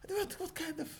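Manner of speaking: high, soft mock bewilderment; mock shock. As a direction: stumble in feigned disbelief. Mock shock at Father Christmas.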